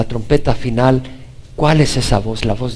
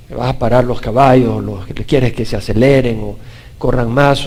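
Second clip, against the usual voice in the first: normal talking voice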